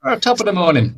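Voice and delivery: mock Irish greeting; hammed-up Irish lilt; Irish accent. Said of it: "you're doing a Greek accent".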